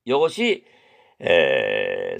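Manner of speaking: ordinary talk, nothing marked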